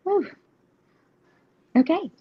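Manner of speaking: ordinary talk, nothing marked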